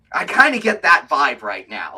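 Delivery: ordinary talk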